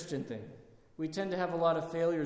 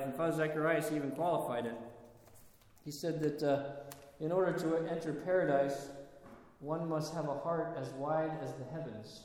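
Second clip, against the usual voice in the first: normal talking voice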